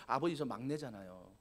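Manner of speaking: soft, low sulky excuse to father; a youngest son sulking to his father, softly and low; whiny excuse. Retold or imitated. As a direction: mope like a reluctant youngest son. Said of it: "어떻게 말할 수 있을까요".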